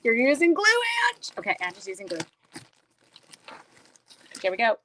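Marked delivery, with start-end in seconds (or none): 0.0-1.3 imitated incredulous viewers. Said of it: "You're like".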